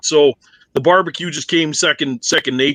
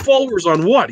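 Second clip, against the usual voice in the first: higher, baffled question; teller's retold reply, higher and puzzled; puzzled retort; 7 semitones higher than usual. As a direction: ask it like it makes no sense. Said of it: "and I said".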